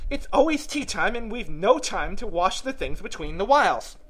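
high, animated Hatter voice; chatty, know-it-all Hatter. Acted. Hatter explaining to Alice how time obliges, eager high voice. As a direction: explain eagerly, pitched well up.

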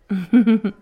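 a short, bright burst of laughter; loud laugh. Acted. Bartender laughs loudly while teasing the girl. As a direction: laugh at your own teasing. Teasing about her chest.

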